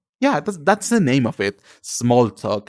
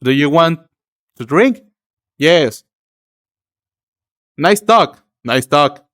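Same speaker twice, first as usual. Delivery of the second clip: stiff, louder one-line replies; short, over-projected awkward chitchat; awkward small talk; 3 semitones higher, 6 dB louder, slower than usual. Acted. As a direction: act out stilted small talk, raised and louder. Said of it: "I'm just like".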